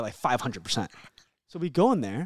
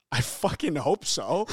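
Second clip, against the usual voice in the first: slow, dead-serious retort; deadpan comeback; 2 semitones higher, slower than usual. Retold or imitated. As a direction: stare him down and say it slowly. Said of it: "look him dead in the eye and go".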